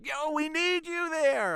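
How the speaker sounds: high, slow, drawn-out impression; imitating Chappelle. Chappelle asking him to stay, high and slow. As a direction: stretch it out like a laid-back buddy pleading. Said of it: "And Chappelle was like".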